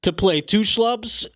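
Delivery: high, furious rant at the studio execs; full-on meltdown. Acted. Pitch rejected, ranting furiously at the network execs. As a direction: lose it completely at the execs. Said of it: "have a full-on meltdown".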